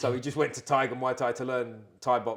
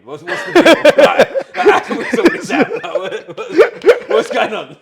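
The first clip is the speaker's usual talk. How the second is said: high, loud, laughing plea; cracking up while retelling his demand; laughing through it; 13 semitones higher, 16 dB louder, slower than usual. Retold or imitated. Retelling how he barged in, laughing hard.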